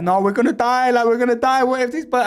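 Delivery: high-pitched imitation of a panicking friend; mock tearful panic. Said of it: "My boy is literally in tears".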